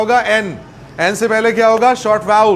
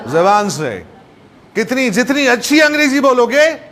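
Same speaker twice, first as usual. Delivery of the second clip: ordinary delivery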